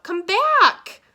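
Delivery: high, loud yell; calling out. A narrator's reading. Mister Sims orders his cat back, calling out. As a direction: yell after a runaway pet. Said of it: "Mister Sims called out".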